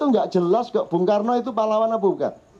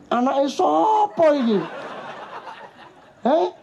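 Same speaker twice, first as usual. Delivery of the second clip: higher, slow, laughing indignation; mock outrage; 9 semitones higher, slower than usual. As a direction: act scandalised through a laugh.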